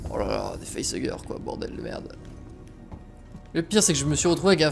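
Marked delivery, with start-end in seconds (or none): 0.1-2.1 dismayed mutter. Real, not acted. Dismayed by facehuggers.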